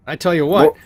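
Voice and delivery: high boastful sailor voice; boasting recruiter. Acted. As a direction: show off the prize, proud of it.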